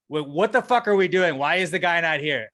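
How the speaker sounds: loud, exasperated demand; angry demand. Retold or imitated. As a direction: push her for answers. Angry call to the office lady.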